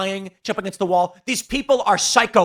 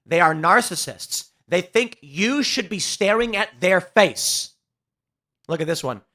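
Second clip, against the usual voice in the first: ordinary talk, nothing marked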